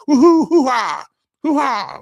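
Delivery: loud high-pitched hoo-ha holler; hooting whoop. Shown as a demonstration. Host demonstrating the hoo-ha for the meme roulette, whooping. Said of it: "laugh and hoo-ha together".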